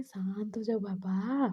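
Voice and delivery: slow, soft, drawn-out calming; soothing hug. Acted. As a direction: soothe them gently, stretching the words. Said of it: "हक करता है और कहते हैं". (धीमे, नरम लहजे में दिलासा)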